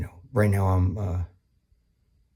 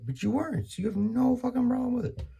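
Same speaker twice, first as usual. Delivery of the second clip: mock outrage; 3 dB quieter than usual. Sarcastic rant peak.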